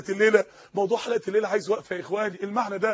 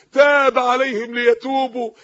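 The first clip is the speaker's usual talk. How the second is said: Quran recitation; 5 dB louder, slower than usual. Acted. Quoting a Quran verse.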